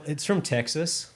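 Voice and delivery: mock Texas accent. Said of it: "that thick Texas drawl".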